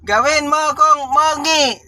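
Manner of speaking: ngongo voice. Retold or imitated. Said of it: "sabi niya".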